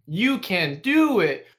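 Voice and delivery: high, loud, slowly stretched fake encouragement; sarcastic cheer. Shown as a demonstration. Worker demonstrating the second coded insult, sarcastic cheer. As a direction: needle them with drawn-out encouragement. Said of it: "in the most fucking sarcastic way possible".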